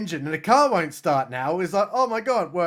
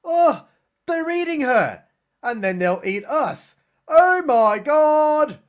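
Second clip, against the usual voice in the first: put-on high, panicky girls, ending in a drawn-out louder wail; hammed-up terror as the victims; mock panic; 8 semitones higher, 4 dB louder, slower than usual. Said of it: "And the girls are like".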